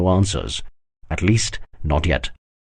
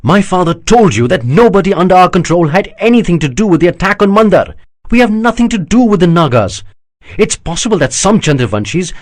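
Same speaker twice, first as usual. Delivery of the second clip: an outraged prince, voice raised and pitched up; higher, louder bitter defence against the charge; indignant prince; 11 semitones higher, 6 dB louder than usual. Acted. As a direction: protest the insult, growing heated. Prince insists on innocence.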